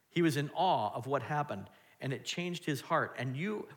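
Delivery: ordinary delivery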